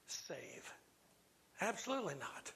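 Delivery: near-whisper; hushed. Real, not acted. Preacher asks if anything is too bad for Jesus, hushed. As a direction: drop to a whisper on the last word. Rhetorical question dropped to a hush.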